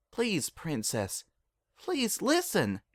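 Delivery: a quiet desperate plea; soft pleading. A narrator's reading. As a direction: beg quietly, voice climbing. Applejack pleads softly.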